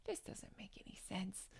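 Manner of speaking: hushed, voiceless whisper; whispered aside. Real, not acted. Vlogger wondering aloud about the photos, whispered aside.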